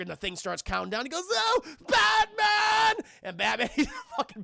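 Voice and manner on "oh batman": long, high shouted call; hammed-up panicked holler; mock cry for help. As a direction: drag the name out at full voice. Joker calling out for rescue.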